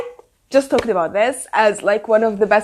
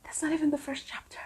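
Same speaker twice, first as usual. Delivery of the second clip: fast, breathy whisper; 2 semitones higher, 21 dB quieter, much faster than usual. Real, not acted. Stunned by the prologue, she whispers to viewers. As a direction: whisper it in disbelief. Overwhelmed after reading sad passage.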